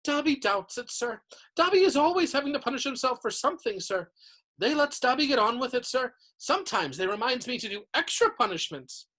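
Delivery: high-pitched reverent house elf; adoring house elf. A narrator's reading. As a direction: gush like a devoted little servant. Dobby explains punishments.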